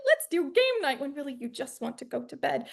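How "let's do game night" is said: higher, bubbly invitation; mock cheerful invite. Indie crew inviting to game night, mock bubbly. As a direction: sell game night too hard. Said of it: "everything is like".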